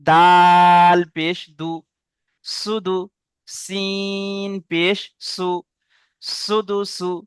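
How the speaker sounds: letter names long drawn out and level; slow, measured drill for pupils to copy; demonstrated spelling recitation. Shown as a demonstration. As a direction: chant each letter very slowly, then join the syllables. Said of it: "একটা পড়ে দেখিয়ে দিচ্ছি".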